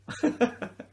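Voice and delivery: a quick high breathless laugh; breathy laugh. Real, not acted. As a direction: laugh under your breath at your own joke. Laughing at his own crack pipe joke.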